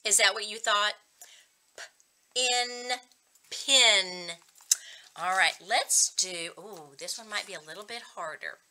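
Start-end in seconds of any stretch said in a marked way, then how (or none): 1.8-4.3 "B", "in", then "bin" blended louder; sound blending. Shown as a demonstration. Teacher to young readers, blending sounds slowly into a word. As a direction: say "B" and "in" apart, then join them into "bin". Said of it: "p sound at the beginning".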